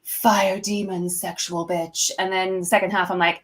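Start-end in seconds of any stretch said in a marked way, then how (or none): 0.0-2.1 slow, drawn-out sultry show intro; slow stage-siren announcement; fire-demon stage persona. Acted. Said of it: "when I do a show and I'm like".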